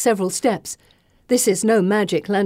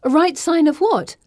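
sharp question; 9 semitones higher, 4 dB louder than usual. Acted. Narrator challenging Bessie sharply.

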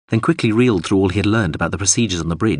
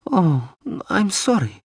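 high, slow, sorry small woman; high woman's voice; 12 semitones higher, slower than usual. A narrator's reading. Dorolo's sympathy, high voice.

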